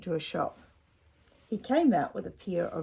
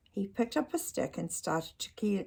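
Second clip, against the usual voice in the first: plain reading voice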